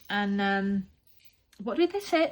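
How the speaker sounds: normal talking voice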